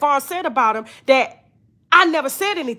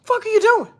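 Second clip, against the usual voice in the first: imitating him yelling, voice pitched up; mock frantic yell; 7 semitones higher than usual. Imitating Von yelling at Chelsea, frantic mock yell. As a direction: play him losing it, throwing things and yelling. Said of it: "He fucking throwing, he hitting his head".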